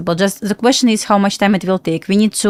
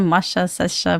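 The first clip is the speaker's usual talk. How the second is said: usual speaking voice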